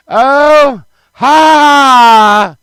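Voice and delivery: long, loud high "ha" cry; mock scream. Four-year-old cornered by clowns, high loud outburst. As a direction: let out a high, drawn-out yell.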